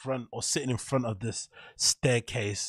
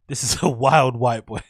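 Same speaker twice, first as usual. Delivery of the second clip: laughing; 8 dB louder than usual. Real, not acted. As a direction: laugh breaking into the words. Amused reaction to the clip.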